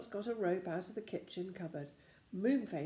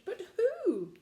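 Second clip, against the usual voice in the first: puzzled; 13 semitones higher, 8 dB louder than usual. A narrator's reading. Puzzled question in story.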